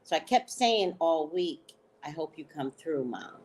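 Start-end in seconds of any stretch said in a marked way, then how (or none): 2.0-3.4 soft plea. Retold or imitated. Pleading to late mother.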